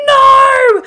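full-lung scream, very high and loud; group of friends screaming in horror at the stripper. Retold or imitated. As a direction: shriek it at the top of your lungs. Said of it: "all of us start screaming".

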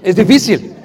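ordinary talk, nothing marked

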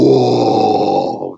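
drawn-out "whoa" of amazed fans; imitated crowd noise. Fans seeing him still standing, imitated awed roar. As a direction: mimic a crowd in disbelief. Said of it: "And the fans are going".

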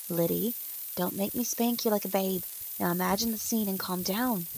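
plain reading voice

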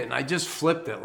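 usual speaking voice

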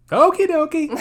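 very high, loud cartoon chirp; mock cheery shrug; silly okie dokie. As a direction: squeak it out cheerfully. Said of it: "they both go".

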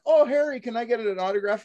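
high-pitched mock fan gushing; starstruck mugger. Mugger asking the actor for an autograph, starstruck. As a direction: coo it high like an excited fan. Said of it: "And they went".

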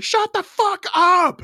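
high, slow mock yell; hammed-up furious shout from a neighbour; angry neighbours. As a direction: bellow it out the window at a singer. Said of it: "a bunch of people are like".

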